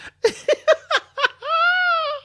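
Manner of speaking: shrill laugh bursting out; high burst of laughter. Real, not acted. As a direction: cackle high and loud. Laughs at one percent.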